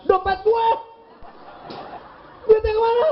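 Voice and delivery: husband yells about his empty wallet; angry shout. Retold or imitated.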